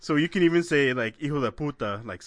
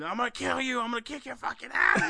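high, hammed-up young threat; threatening kid; 9 semitones higher than usual. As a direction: play an angry teen yelling back. Kid threatens prank callers.

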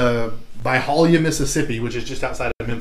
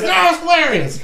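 high squealed mock hysterics; imitated cry-laughing. Imitating drunk friend refusing to call back, cry-laughing squeal. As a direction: squeal through laughter like a hammered prankster. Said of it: "like, cry laughing in the back seat".

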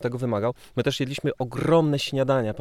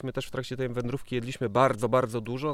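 ordinary talk, nothing marked

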